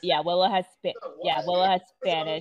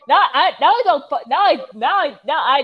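laughing; 6 semitones higher, 8 dB louder than usual. Real, not acted. Laughing at own confusion.

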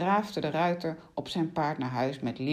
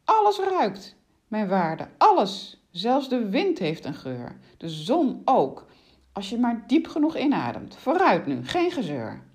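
witch's forceful, higher scolding; insistent and affronted, louder on key words; indignant witch; 6 semitones higher, 5 dB louder than usual. Acted. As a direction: lecture the victim emphatically, raised voice. Plays indignant witch lecturing rider.

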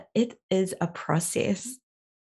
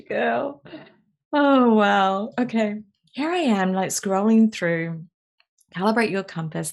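ordinary talk

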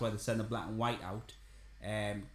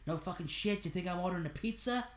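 higher, sarcastic action-hero snap; Bruce Willis twang; 6 semitones higher than usual. Retold or imitated. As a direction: throw the line back like a fed-up cop. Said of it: "he says it in the Bruce Willis twang".

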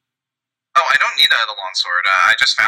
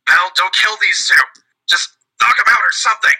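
raised, high-pitched call mid-fight; lightly acted yell to an ally; 8 semitones higher than usual. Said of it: "he's just gonna yell out".

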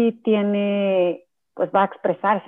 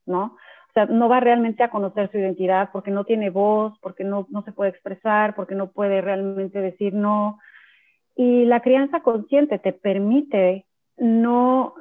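ordinary talk

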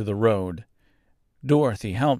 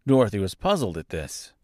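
plain reading voice

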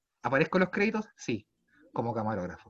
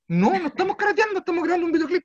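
indignant protest; 11 semitones higher, 3 dB louder, faster than usual. Retold or imitated. Insisting to the guard.

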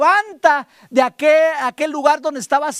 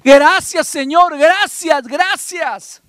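thankful praise; 5 semitones higher, 6 dB louder, much slower than usual. Shown as a demonstration. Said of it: "y decir".

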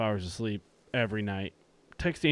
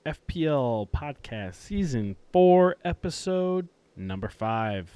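slow, drawn-out podcast welcome; host announcing the episode, savouring each word; show intro; 3 semitones higher, 4 dB louder, much slower than usual. Acted. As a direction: stretch out the announcer-style opening. Opens the show over music.

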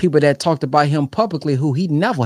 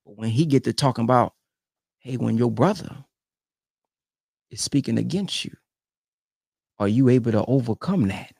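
usual speaking voice

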